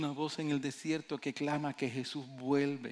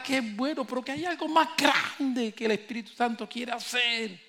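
loud, high, slow preacher's cry, climbing; soaring revival preaching; full-force preaching; 9 semitones higher, 8 dB louder than usual. Acted. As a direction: build to a roaring climax. Preacher builds to a climax.

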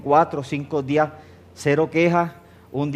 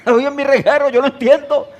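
high and louder, defending the mess; mock insistent protest; 10 semitones higher, 6 dB louder than usual. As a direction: insist it's his mess. Voicing himself in argument. (protesta insistente imitada, aguda y fuerte)

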